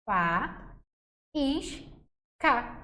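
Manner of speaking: "fa, is, ca", one syllable at a time with clear pauses; divisão silábica. Shown as a demonstration. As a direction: split it slowly into "fa, is, ca". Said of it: "Olha a divisão silábica". ("fa, is, ca" sílaba por sílaba, com pausas)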